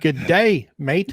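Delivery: hammed-up Australian greeting; Australian accent. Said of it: "Wait till you hear my Australian accent".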